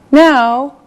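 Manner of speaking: usual speaking voice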